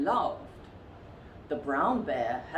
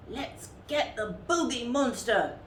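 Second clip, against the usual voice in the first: shouting little bear; 7 semitones higher than usual. A narrator's reading. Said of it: "shouted the littlest one".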